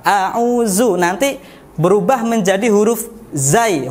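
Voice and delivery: normal talking voice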